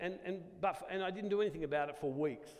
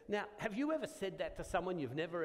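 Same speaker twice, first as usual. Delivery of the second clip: normal talking voice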